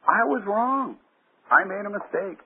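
high, earnest admission; humble admission voice. Retold or imitated. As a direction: say it like owning up. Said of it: "as a personality can say than".

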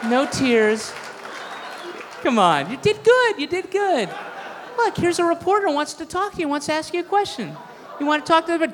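put-on high, cooing voice as if to a crying child; mock coddling parent. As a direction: soothe him like a kid who lost a race. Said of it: "we're like".

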